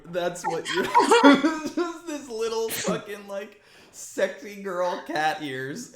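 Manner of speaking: high, laughing, drawn-out words; helpless laughter. Real, not acted. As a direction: barely get it out through real laughter. Cracking up at the reveal.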